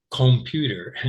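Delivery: "Computer" with a long O in the first syllable, "COME" as "kome"; mispronounced "Computer", full O as in "home". Shown as a demonstration. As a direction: say "Computer" starting "kohm-". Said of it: "long O?".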